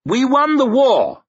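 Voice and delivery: high, loud victory cry; ringing royal shout; triumphant proclamation. A narrator's reading. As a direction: proclaim it to the whole hall. King proclaiming victory to lords.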